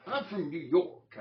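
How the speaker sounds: high, loud, drawn-out mock New York accent; exaggerated second stab at a New Yorker; badly faked New York accent. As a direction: push a hammed-up New York accent, slowly and loudly. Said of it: "I can't do the accent".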